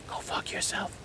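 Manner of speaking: voiceless, hushed mock insult; whispered dismissal. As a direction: drop to a whisper for the mock threat. Muttered threat after sweet offer.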